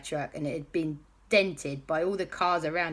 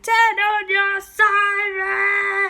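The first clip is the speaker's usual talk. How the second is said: high, louder, drawn-out yell; slow loud shout; 9 dB louder, slower than usual. Acted. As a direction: stretch the shout as loud as you can. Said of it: "as loud as they possibly could".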